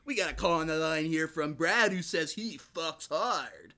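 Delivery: lightly voiced radio host; a hint of a morning-show host. Radio host takes a call.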